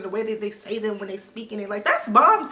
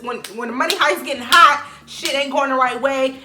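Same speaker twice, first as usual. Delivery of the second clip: ordinary delivery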